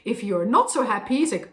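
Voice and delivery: ordinary delivery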